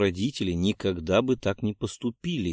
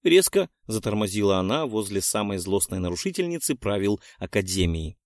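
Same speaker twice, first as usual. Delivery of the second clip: plain reading voice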